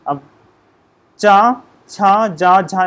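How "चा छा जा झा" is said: चा and छा stretched very slowly and a bit louder; teacher modelling चा छा जा झा; slow sound demo. Shown as a demonstration. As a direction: drag out each syllable so students hear it. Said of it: "ऐसे बोल के देखिए".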